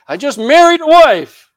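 high, loud put-on excuse; mock excuse. Invited guest who can't come, hammed-up excuse. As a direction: plead it loudly, hamming up the lame excuse.

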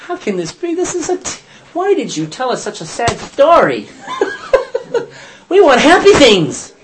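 high, whining mock protest with a laugh; whiny disciples. Teacher mimicking the sulking disciples, high and whiny. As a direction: play the disciples sulking like kids who want a happy story. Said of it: "They were like".

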